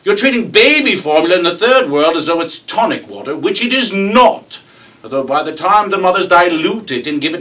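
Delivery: high, shouted British tirade; mock-indignant rant, building to a bellow; sputtering outrage. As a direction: sputter like a boiling kettle, imitating an irate young Brit. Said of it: "he sputters like a boiling tuber".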